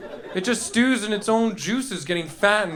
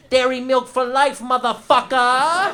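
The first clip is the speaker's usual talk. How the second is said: higher, louder hammed-up thug threat; menacing dairy farmer; 7 semitones higher, 6 dB louder than usual. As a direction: threaten him at gunpoint, over the top. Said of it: "holds a gun to your head and goes".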